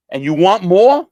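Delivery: sudden loud furious retort; angry yell. Retold or imitated. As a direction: bark it at the man who owes you. Drug dealer confronts debtor.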